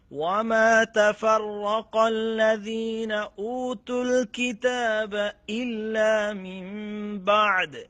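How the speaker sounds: Quran recitation. Shown as a demonstration. Teacher reciting the verse for students, slow melodic chant. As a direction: chant it with long held vowels. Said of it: "এখানেই ওয়াকফ করেন".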